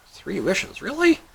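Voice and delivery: soft, high-rising disbelief; incredulous. Acted. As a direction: ask it softly, leaping up high at the end. Guy cannot believe the offer.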